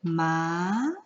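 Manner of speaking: "ma" drawn out, sliding upwards; rising second tone. Shown as a demonstration. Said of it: "is a rising tone".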